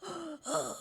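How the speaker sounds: a sudden strained gasp for air; father's labored breathing, imitated. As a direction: mimic a sick man's hard breath. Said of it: "숨 쉬는 소리가 이제".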